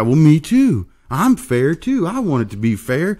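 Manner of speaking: high, eager mock voice of the green racer; mock naive racer. Racer mocked to the crew, high eager voice.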